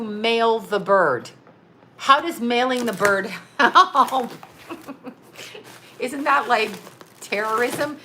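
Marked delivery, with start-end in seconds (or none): 2.0-6.0 cracking up. Real, not acted. Telling the story of mailing a bird, cracking up. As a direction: question breaking into laughter. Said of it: "I'm cracking up".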